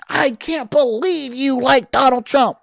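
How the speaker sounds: high, slow, whiny mock voice; a lightly hammed-up upset voter; mock outraged Democrat. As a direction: mimic a distraught liberal, drawn out. Mocking a panicked Democrat.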